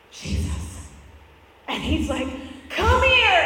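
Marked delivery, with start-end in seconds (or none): 0.1-0.9 hushed, voiceless single word; acted breath of a whisper; whispered call. Believer timidly calling on Jesus. 2.7-3.4 loud, high, excited shout; acted joyful holler; eager call. Jesus eagerly calling you to him.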